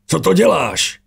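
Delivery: high, sharp shout; roared demand. A narrator's reading. Déric shouts at the villain as ash falls, loud. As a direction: yell it up at the sky. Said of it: "Zařval Déric".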